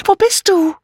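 sehr hohes Rufen; rufend. Acted. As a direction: rufe suchend mit hoher Kopfstimme. Sucht rufend die Freundin. (laut suchendes Rufen)

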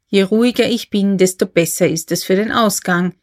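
ordinary delivery

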